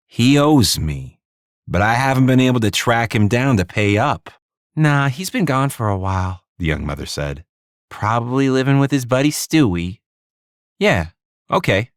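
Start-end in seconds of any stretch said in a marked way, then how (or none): 0.1-4.3 tough New York hustler voice; New York accent. Acted. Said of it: "inserting a heavy New York accent into her tone". 4.8-6.4 put-on young mother voice. Wary young mother answers. 7.9-10.0 put-on young mother voice. Wary young mother answers. 10.8-11.9 put-on New York accent. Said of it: "Di played along beautifully".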